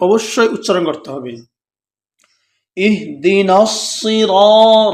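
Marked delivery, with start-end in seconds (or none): 2.8-4.9 সিরা with a heavy, full রা held one alif; heavy ra in সিরা, held one beat; full-mouthed ra. Shown as a demonstration. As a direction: stretch সিরা with a thick ra. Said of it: "রটা পুর হবে".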